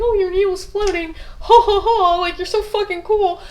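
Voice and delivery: high, laughing mock praise from the auditor. The auditor mocks her needle with a laugh. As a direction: sneer through a fake laugh at her. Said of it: "and he'd be like".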